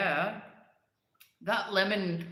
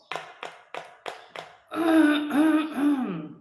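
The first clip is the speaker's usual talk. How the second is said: throat clearing; 9 semitones higher, 7 dB louder than usual. Real, not acted.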